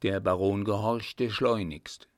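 ordinary delivery, nothing marked

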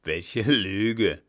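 loud, outraged rejoinder; captain's forceful, offended reply; indignant retort. A narrator's reading. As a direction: throw it back loudly as an accusation. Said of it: "erwiderte der Kapitän".

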